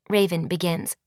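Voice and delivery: ordinary delivery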